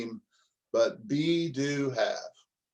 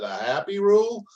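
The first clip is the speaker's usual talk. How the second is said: high, loud questioning rise; astonished question, pitched way up; incredulous echo; 8 semitones higher, 5 dB louder than usual. Retold or imitated. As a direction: repeat it back in disbelief. Incredulous echo of her answer.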